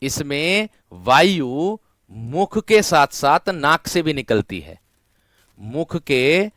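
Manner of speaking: drawn out, word by word; teacher hammering in the rule slowly; slow emphatic explaining. Shown as a demonstration. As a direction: stretch each word for the class. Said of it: "इसकी पहचान यही है".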